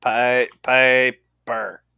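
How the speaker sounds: "Piper" drawn out very slowly; sounding out. Acted. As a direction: carefully stretch each syllable of "Piper". Trying to get the name right.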